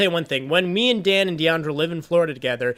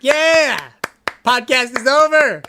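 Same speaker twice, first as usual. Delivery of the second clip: excited; 8 semitones higher, 6 dB louder, slower than usual. Real, not acted. Loud sign-off cheer.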